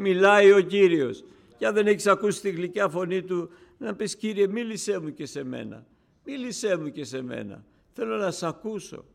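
«Μιλάει» thrown out loud and high; loud fervent sermon voice; loud preaching. Acted. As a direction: proclaim «Μιλάει ο Κύριος» to the hall. Preaching continues.

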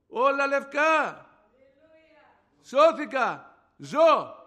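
short, loud, high shouts; exultant cries with pauses between; triumphant testimony. Retold or imitated. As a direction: proclaim each victory separately, at full voice. Climax of healing testimony.

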